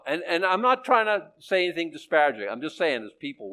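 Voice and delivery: usual speaking voice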